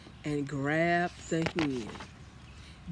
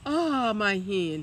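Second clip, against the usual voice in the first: louder slow giant exclamation; giant cries out; 5 semitones higher, 5 dB louder, slower than usual. A narrator's reading. Giant finds his hen, slow and louder. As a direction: cry out for the hen. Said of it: "he cried".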